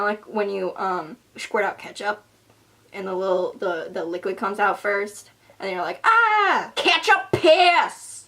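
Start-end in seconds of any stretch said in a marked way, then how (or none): 6.0-8.2 high, loud, stretched-out shriek; hammed-up high yelp, dragged slow; mock shriek. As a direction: squeal it like ketchup just splattered. Mock reaction to ketchup squirt.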